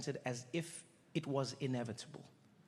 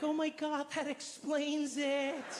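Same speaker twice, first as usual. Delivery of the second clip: higher, slow dawning realization; mock realization; 11 semitones higher, much slower than usual. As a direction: let it dawn slowly. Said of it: "people are like".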